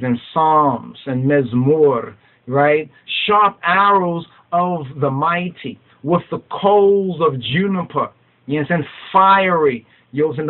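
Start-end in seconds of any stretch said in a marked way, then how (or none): 3.2-8.1 slow proclaimed verse. Acted. Reads verse aloud, slowed. 9.1-9.9 loud, high burst on one word; fiery cry. Real, not acted. Preacher cries out that the word is fiery, loud and high. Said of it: "The word is fiery".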